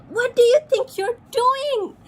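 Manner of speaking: high, loud, wailing scold; furious duck. Acted. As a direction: screech the rule at them. Duck shouting at frogs.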